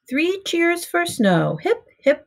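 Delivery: storyteller's high cheer. A narrator's reading. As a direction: lead a rousing cheer.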